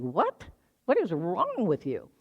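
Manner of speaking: put-on incredulous child questioning mom; retold disbelief. Child to mom leaving for ladies' room, incredulous. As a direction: act out a baffled kid, pitch swooping.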